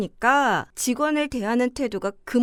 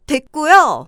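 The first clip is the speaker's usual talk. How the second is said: curt cut-off; 8 dB louder, slower than usual. Acted. Wife cuts off the boss.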